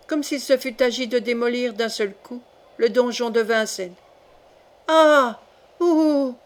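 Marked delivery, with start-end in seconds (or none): 4.9-6.4 high, loud "ah" then "ooh"; cry of pain. A narrator's reading. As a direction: cry out in varied tones. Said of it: "cria Coconas, sur les intonations les plus variées". (cri de douleur aigu et varié)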